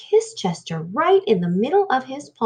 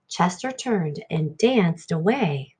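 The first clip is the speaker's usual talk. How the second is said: usual speaking voice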